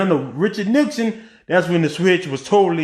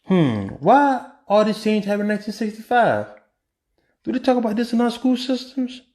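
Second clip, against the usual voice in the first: mock wondering; 4 semitones higher than usual. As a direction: play at being puzzled, leading to the answer. Feigning puzzlement before revealing answer.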